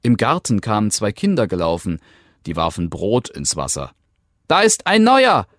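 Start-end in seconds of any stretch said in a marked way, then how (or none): 4.5-5.5 high, loud excited call; children calling out. A narrator's reading. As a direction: shout it out like eager children. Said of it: "riefen sie".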